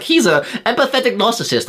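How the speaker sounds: high, smug pseudo-clever voice; mock critic. Mocking critics using psychology terms, high smug voice. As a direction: sneer the jargon like a know-it-all. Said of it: "they'll use big psychological terms".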